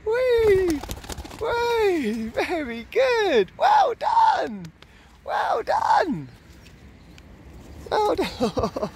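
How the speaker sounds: very high squealing praise; squeaky praising a dog. Real, not acted. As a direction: squeal with delight at a pet. Cheering pigeon's flight.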